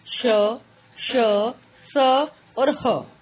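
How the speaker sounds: slow, careful स and ह; धीमे उच्चारण; slow letter demo. Shown as a demonstration. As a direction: say स and ह slowly for students. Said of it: "ये हैं".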